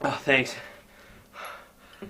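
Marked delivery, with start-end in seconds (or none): 2.0-2.1 out of breath. Real, not acted. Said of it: "so out of breath".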